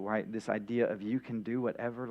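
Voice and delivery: usual speaking voice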